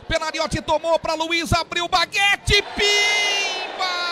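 long stretched goal scream; explosive goal commentary. Acted. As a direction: roar the goal call, drawing out gol. Narrator calls a goal.

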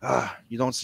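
ordinary talk

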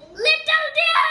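loud furious yell at the deer; angry shout. Acted. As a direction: roar the name in rage. Tiger roars for the deer.

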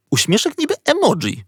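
ordinary delivery

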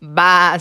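loud wobbling bleat; big sheepy baa; sheep's baa. A narrator's reading. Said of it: "said the sheep".

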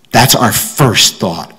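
ordinary talk

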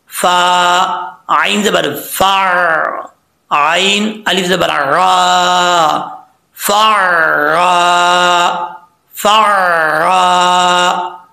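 slow, drawn-out syllables; slow spelling recitation. Shown as a demonstration. Teacher spells the word slowly for students. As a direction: sound each letter slowly. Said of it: "कैसे?".

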